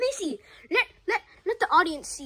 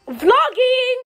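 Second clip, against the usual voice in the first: vlog shout; 6 semitones higher, 15 dB louder than usual. Real, not acted. Vlog announcement.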